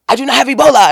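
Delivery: imitating him, a touch of an upset protest; upset denial. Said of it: "and he's so upset".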